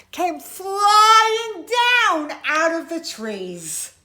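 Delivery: slow, loud, high drawn-out words; big sing-song storytelling swoop; swooping climax. A narrator's reading. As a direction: stretch it like caps sailing down. Caps tumble from the trees.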